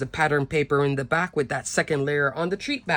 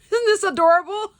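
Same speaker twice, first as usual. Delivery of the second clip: gushing delight; 5 dB louder than usual. Real, not acted. Gushing over a cute card.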